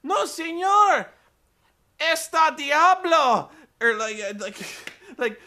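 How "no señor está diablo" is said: much higher, louder, slow, strained mock Spanish. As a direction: drag out the warning in a hammed-up Spanish voice. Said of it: "she's like".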